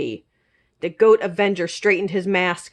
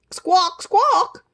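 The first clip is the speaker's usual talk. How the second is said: high, squawking bird call; bird squawks; 10 semitones higher than usual. Acted. Bird answers the princess, high squawks. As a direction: squawk like a gull. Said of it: "said the bird".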